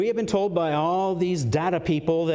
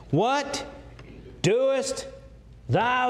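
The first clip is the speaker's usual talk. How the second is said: "What", "doest", "thou" each high and separate; high, deliberate "What... doest... thou"; emphatic word by word; 8 semitones higher, much slower than usual. Shown as a demonstration. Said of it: "put my emphasis on it here".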